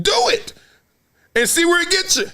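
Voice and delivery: high, laughing challenge; amused high-pitched dare. Real, not acted. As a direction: dare them through a laugh. Daring critics, laughing.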